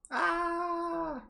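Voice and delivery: sharp high scream on waking. Acted. Karma screams on waking from the loop. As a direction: scream yourself awake.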